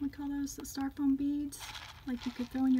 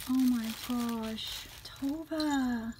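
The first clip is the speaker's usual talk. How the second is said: ordinary delivery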